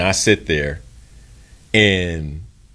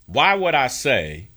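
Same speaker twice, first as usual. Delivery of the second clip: normal talking voice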